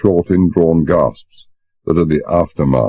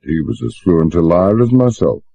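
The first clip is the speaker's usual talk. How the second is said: usual speaking voice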